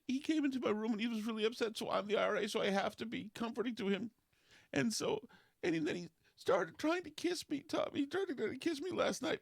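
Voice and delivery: high, fast mock girlish voice; imitating girlfriend. Retelling the girlfriend's confession, mock high and rushed. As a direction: ham up her breathless excuses. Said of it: "She said".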